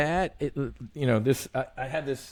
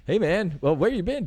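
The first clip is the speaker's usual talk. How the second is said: higher, easy buddy greeting; casual greeting; 8 semitones higher than usual. Retold or imitated. Greeting God like a buddy, higher casual. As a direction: toss it off like meeting a friend. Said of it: "I was just kinda like".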